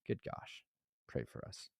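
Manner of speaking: hushed, voiceless plea; weary whisper. Real, not acted. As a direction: whisper it as a worn-out aside. Weary plea after listing troubles.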